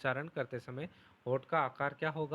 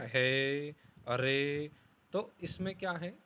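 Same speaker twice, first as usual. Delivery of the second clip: normal talking voice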